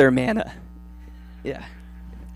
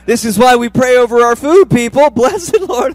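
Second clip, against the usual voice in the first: shouted out with a laugh, high and loud; loud laughing exclamation; 5 semitones higher, 10 dB louder than usual. Real, not acted. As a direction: belt the punchline laughing. Joking punchline, loud, laughing.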